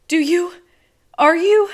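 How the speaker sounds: high, loud, panicky asking; she's terrified and blurts it out; frightened high questions. A narrator's reading. Frightened girl questioning narrator.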